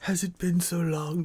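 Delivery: high, soft imitation of a crazy movie voice.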